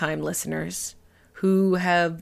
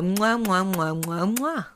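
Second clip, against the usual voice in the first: blown kisses; slower than usual. Real, not acted. Blowing kisses to listeners.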